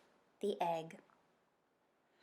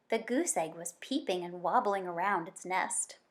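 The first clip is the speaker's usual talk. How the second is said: ordinary delivery, nothing marked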